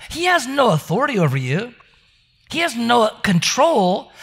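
usual speaking voice

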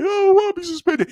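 high-pitched, loud whiny wail; hammed-up schoolboy sob; mock crying. Boy begs teacher not to suspend him, mock crying. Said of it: "then he started crying".

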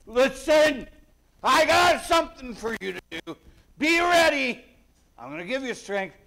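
high, loud insistent voice; urgent command. Retold or imitated. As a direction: grab him by the lapels with your words. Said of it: "grab Joshua kind of by the lapels".

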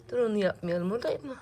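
ordinary talk

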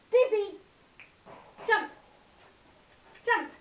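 loud high call to the dog; calling the dog loudly. Real, not acted. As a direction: call her name out, bright and loud.

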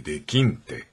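low, heavy refusal in Kyushu dialect; retelling the father in the film, low and heavy; heavy father's voice. Retold or imitated. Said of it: "森重は重たい声で、".

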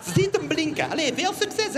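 high squeaky housewife; washerwoman voice. Acted. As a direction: play the cheerful cleaning lady. Washerwoman character.

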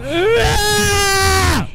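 high, drawn-out scream of fright; real jump-scare scream; startled scream. Real, not acted. Jump scare in horror game.

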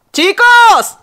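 loud high call across the house; shouting the pets' names. Real, not acted. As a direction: yell for the dogs to come. Calling out to her pets.

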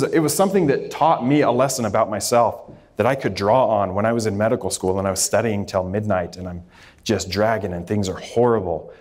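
ordinary delivery

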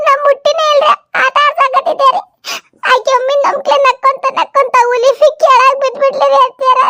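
high, whining plea of innocence; high, nervous young woman denying blame; whiny young woman. Acted. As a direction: protest sweetly that you did nothing.

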